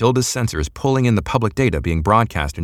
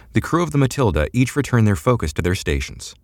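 ordinary delivery, nothing marked

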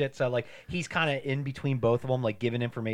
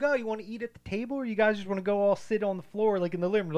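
mock host; 10 semitones higher, 4 dB louder, faster than usual. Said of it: "they're like".

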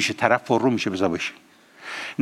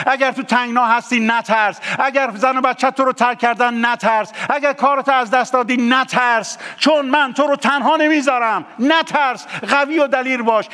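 raised, insistent preacher's voice, hammering each نترس; fiery preaching; 11 semitones higher, 3 dB louder than usual. Acted. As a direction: preach it to the congregation with fire. Preaching at full force.

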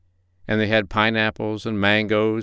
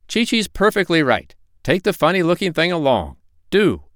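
high-pitched, nagging duck housekeeper; scolding duck; 9 semitones higher than usual. A narrator's reading. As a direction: reproach him in a high duck voice. Voices Dab-Dab scolding.

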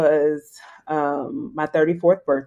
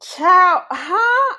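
amazed exclamation; 13 semitones higher, 4 dB louder than usual. Real, not acted. Amazed at fundraising total.

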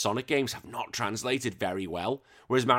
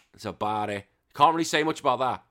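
usual speaking voice

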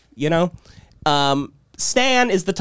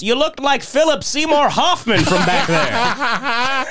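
high, slow, drawn-out call; mock yelled insult; 9 semitones higher, much slower than usual. Man pulling up beside his neighbor, mock yelling. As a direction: holler it out slowly, hammed-up.

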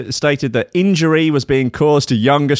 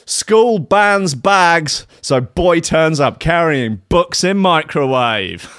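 slow headline read; 5 semitones higher, slower than usual. Acted. Reads the headline slowly.

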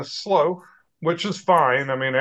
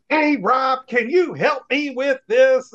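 high, bubbly imitation of a peppy coworker; mock-perky, drawn out and high; 9 semitones higher, slower than usual. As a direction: ask it like an over-peppy office mate. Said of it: "very peppy, I guess".

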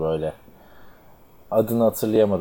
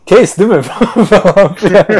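laughing; 10 semitones higher, 10 dB louder than usual. Real, not acted. Cracking up retelling a joke.

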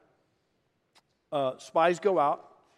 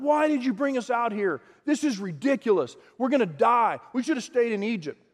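much higher, louder whining complaint; complaining people; 7 semitones higher, 4 dB louder than usual. Retold or imitated. People complaining to the Lord, high loud whine. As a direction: whine that you're going to die. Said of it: "They're going".

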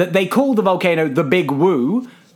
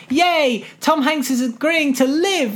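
mock cheering; 8 semitones higher, slower than usual. Mock cheer at film moment.

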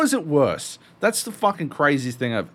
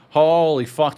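louder, amazed holy fuck; excited holy fuck; 4 semitones higher, 7 dB louder than usual. Real, not acted.

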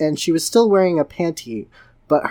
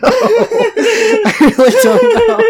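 giggling, rising into high laughter; words broken by laughter; laughing; 5 dB louder, much slower than usual. Real, not acted. Dissolving into laughter.

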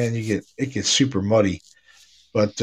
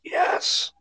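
ghostly whisper; 5 dB quieter than usual. Retold or imitated. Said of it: "It's like".

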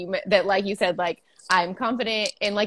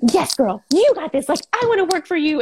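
higher and louder hype; cheering friend; 8 semitones higher, 6 dB louder than usual. Retold or imitated. Friend cheering on her business idea, high and excited. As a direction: gush with excited support.

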